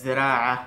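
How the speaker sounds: জেরাআহ said slowly with a long aa, as Arabic; Arabic letter demo. Shown as a demonstration. Teacher models জেরাআহ for Bengali learners, careful. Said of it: "তারপর হচ্ছে জেরাআহ".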